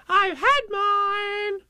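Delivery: very high, slow squeal; proud little Roo. A narrator's reading. Roo boasting about medicine, very high and proud. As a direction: pipe it up proudly, stretching every word. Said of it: "Roo said proudly".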